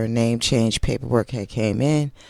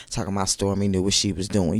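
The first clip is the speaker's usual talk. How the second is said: usual speaking voice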